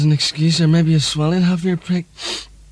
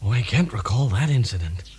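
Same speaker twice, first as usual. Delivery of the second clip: Ronald Reagan impression; 6 semitones lower, 5 dB quieter than usual. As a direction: play a drowsy, forgetful Reagan. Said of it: "Ronald Reagan".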